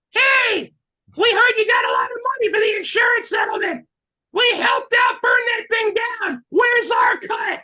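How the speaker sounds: very high yelled demand, hammed up; high-pitched comic yelling; mock demanding shout. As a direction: holler like an outraged kid shaking someone down. Acts out kids confronting the owner.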